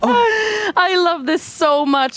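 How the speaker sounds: slow, high words through laughter; barely getting words out between laughs; laughing. Real, not acted. Host still laughing.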